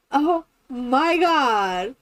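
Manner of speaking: very slow, drawn-out exclamation; amused disbelief. Retold or imitated. Reacting to the girl's teasing, slow disbelief. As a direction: stretch each word out in mock exasperation.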